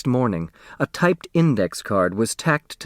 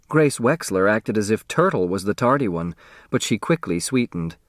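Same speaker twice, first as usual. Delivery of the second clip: ordinary delivery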